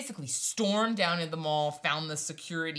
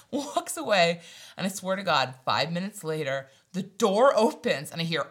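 usual speaking voice

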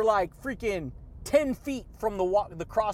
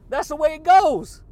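louder and much higher, falling at the end; belted catchphrase; 6 semitones higher, 10 dB louder than usual. Real, not acted. Vlogger belting his catchphrase to viewers. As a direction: belt it like a sign-off.

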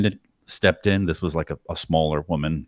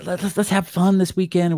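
high, breezy imitation of the wife; wife's voice; 13 semitones higher than usual. Mimicking the wife soothing her husband, high and light. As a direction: chirp it like a cheery wife. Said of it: "and then the wife".

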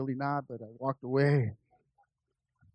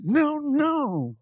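frustrated refusal; 12 semitones higher, 7 dB louder than usual. Retold or imitated. Arguing with God mid-song.

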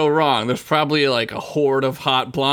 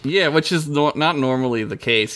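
usual speaking voice

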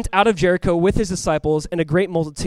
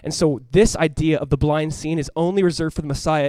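ordinary talk

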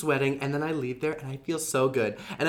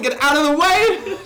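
higher, louder laughing yell; mock panicked yell; 11 semitones higher, 7 dB louder than usual. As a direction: shout it while running with tea. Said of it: "Sarah Paulson".

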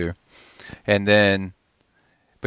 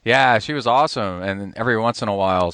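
ordinary delivery

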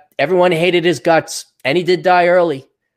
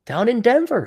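ordinary delivery

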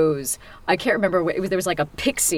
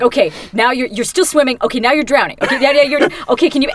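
raised, louder coaching voice; mimicking the director coaching a drowning scene; imitating the director; 5 semitones higher, 6 dB louder than usual. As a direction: call directions into the booth. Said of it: "And you're like".

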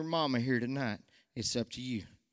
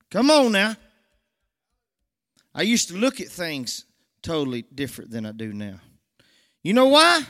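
high, loud call to the congregation; full-force preacher's cry; preaching call; 5 semitones higher, 10 dB louder than usual. Real, not acted. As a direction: rouse the church with it. Preacher rousing the church.

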